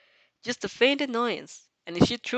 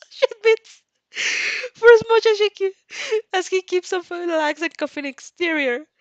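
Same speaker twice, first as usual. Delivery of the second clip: laughing; 9 semitones higher, 6 dB louder than usual. Real, not acted. Reader laughing through narration.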